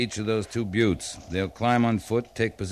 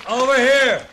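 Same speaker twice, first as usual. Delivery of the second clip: higher, louder call; 14 semitones higher, 8 dB louder than usual. Acted. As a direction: call across the camp. Captain calls to the scout.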